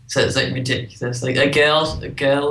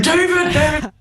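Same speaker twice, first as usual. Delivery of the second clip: calling out a name loudly; high excited shout; excited yell; 14 semitones higher, 4 dB louder than usual. Retold or imitated. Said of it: "I was like".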